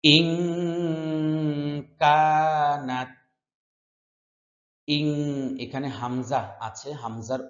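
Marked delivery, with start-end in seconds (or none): 0.0-5.1 slow melodic chanted recitation; Quran recitation. Shown as a demonstration. Teacher chanting verse slowly for students. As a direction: chant each word drawn out for students to repeat.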